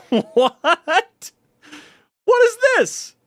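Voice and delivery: high-pitched, disbelieving cry; incredulous laughing. Real, not acted. Streamer startled by archive findings, incredulous outburst.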